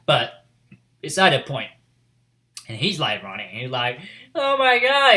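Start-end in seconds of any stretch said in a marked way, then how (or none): 4.3-5.2 very high, loud outburst; mock-wail in the character's panic; retold anguished cry. Retelling him running and crying blood, high anguished cry.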